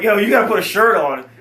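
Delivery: hammed-up telling off; mock yell at brother. To his shirtless brother in the hotel room, a loud mock yell.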